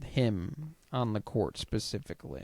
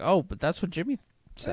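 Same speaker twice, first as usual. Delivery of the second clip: quick, higher mock excuse; 6 semitones higher, faster than usual. Co-host mocking a coach blaming his player, fast. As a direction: pin it on Jimmy, fast. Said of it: "He's like".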